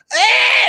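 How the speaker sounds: sudden very high yelp of a diving player; mock falling yelp. Mocking soccer players who fall down, with a high yelp. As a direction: squeal like a player taking a fake fall.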